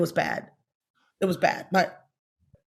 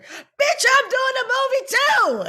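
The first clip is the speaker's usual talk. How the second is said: very high, loud, slow joyous scream; ecstatic shriek; 5 dB louder, slower than usual. Retold or imitated. To Rosario on the phone, shrieking with joy. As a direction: shriek the news out in delight. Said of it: "I was like".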